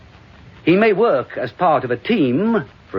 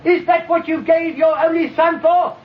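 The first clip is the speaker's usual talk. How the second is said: much higher, raging cry to the heavens; furious shout at God; 14 semitones higher than usual. Acted. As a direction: hurl it upward in fury.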